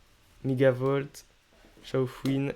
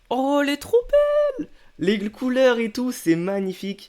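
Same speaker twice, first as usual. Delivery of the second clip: delighted; 10 semitones higher, 5 dB louder than usual. Real, not acted. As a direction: gush over a beautiful card. Delighted at a beautiful card.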